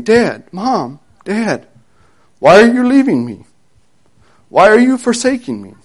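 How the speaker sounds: put-on high, desperate child's cry; a little boy begging his parents not to go; pleading child. Child cries out as parents leave.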